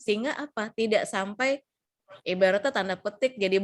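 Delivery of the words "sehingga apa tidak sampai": ordinary delivery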